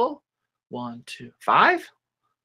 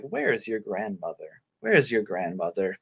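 nice-acting wolf; 2 semitones lower, 7 dB quieter than usual. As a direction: put on gentle niceness to win trust. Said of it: "now he's being very nice".

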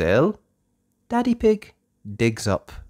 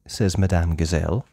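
ordinary delivery

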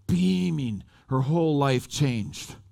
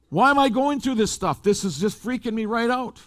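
high-pitched, exasperated retold questioning; retold freaked-out protest; 7 semitones higher than usual. Man recalls questioning his nightmares, freaked out. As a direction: act out being mildly freaked out.

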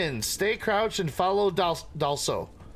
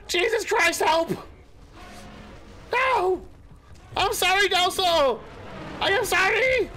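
panicked cries; 11 semitones higher than usual. Real, not acted. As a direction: beg for mercy while fleeing. Chased by angry animal, panicking.

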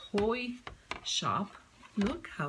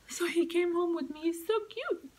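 excited gushing; 10 semitones higher, faster than usual. Real, not acted. Gushing over a garden figure.

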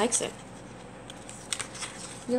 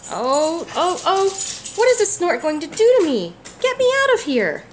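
higher, louder frightened pleading; scared; 8 semitones higher, 6 dB louder than usual. Acted. Baby bird carried off by the digger, frightened and high. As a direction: panic as you're lifted away.

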